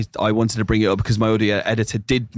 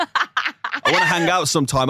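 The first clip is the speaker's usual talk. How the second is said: laughing through a mock line; amused put-on quote; 7 semitones higher than usual.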